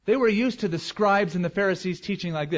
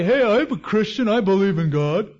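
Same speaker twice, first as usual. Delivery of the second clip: higher, louder mock cheerful claim; mock breezy believer; 5 semitones higher, 6 dB louder than usual. Preacher mimicking shallow churchgoers, loud breezy mock claim. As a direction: ham up a casual believer.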